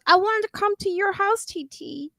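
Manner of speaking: put-on high little-girl voice; little niece. Niece asking auntie to come over, imitated little-kid voice. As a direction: imitate a small child pleading to visit. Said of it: "But she always goes".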